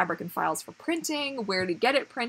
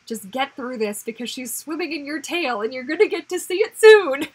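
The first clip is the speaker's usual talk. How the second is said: pep talk building to a high, loud squeal; self pep talk; 4 semitones higher, 7 dB louder than usual. Pretend. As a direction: talk yourself through it, rising into giddy excitement. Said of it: "I'm just gonna be like".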